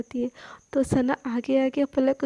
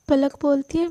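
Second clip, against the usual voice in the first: usual speaking voice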